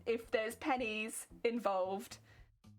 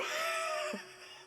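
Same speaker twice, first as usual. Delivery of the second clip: sudden high squeal of a laugh; high burst of laughter. Real, not acted. Laughing at a chat joke about jellyfish, high shriek of laughter.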